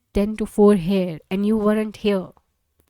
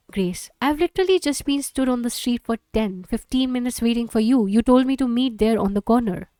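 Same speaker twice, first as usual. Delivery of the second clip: normal talking voice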